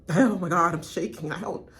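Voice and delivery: usual speaking voice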